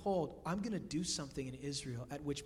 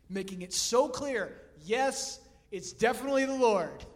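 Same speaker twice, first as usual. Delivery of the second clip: emphatic; 3 semitones higher, 9 dB louder than usual. Real, not acted. Emphatic preaching climax.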